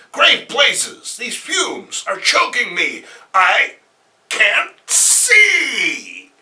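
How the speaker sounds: loud, high, slow cry of distress; loud hammed-up distress; choking hero. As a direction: shout through the choking fumes.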